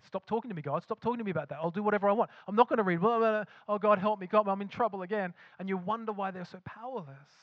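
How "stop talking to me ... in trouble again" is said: higher and louder, hammed-up brush-off of God; mock defiant rant. Believer snapping at God, then begging for help: mock loud rant.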